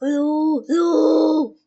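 loud high ooh sounds; silly ooh. Pretend. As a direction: hoot into the microphone. Playing with recording equipment.

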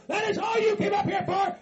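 high, shouted disbelief; riled up. Acted. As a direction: throw the question back as an angry yell. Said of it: "You're getting all riled up".

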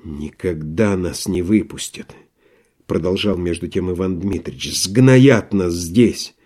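низко и тихо, медленно; глухо, обречённо; мрачное отчаяние. Acted. Иван Дмитрич мрачно. (тихо и медленно, обречённо)

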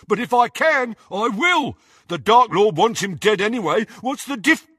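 high-pitched heated rant, cut off; defiant yelling. A narrator's reading. Crabbe argues Potter can die, heated yell. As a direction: keep yelling back, defiantly. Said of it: "yelled Crabbe".

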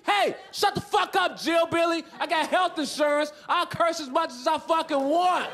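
high, defiant cursing outburst; hammed-up snapping out. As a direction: yell back at the nurse, pitched high. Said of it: "I was snapping out".

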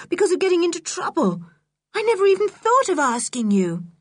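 put-on high, excited girl, words tumbling out; joyful. George thrilled that Julian will come, joyfully high. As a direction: gasp of delight, then a rush of happy relief. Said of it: "lifted joyfully".

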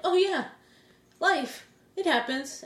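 high-pitched, breezy shrug-off; mock nonchalance. Imitating people unbothered by sickening things, mock nonchalance. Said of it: "how could you say that so nonchalant".

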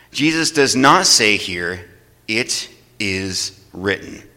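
slow, with "not" leaned on; stressed contrast. Real, not acted. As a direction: point out what Jesus does not say. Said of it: "notice something very particular".

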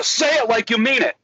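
high, urgent pep-talk demand; emphatic coaching. Retold or imitated. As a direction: urge them hard to commit. Coach urging again, more intensely.

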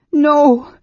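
high, loud moaned refusal; helpless moan. A narrator's reading. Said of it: "she moaned helplessly".

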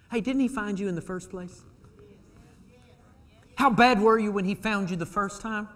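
ordinary talk, nothing marked

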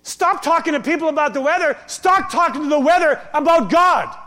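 loud, driving sermon voice; fiery preacher building up; preaching at full force. Acted. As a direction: preach it hard to the room.

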